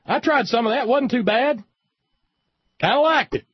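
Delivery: high, loud put-on voice of a grudging convert; union guy. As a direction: squeak it out like a gruff guy admitting he liked it. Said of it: "one of those wonderful, uh, union folks says".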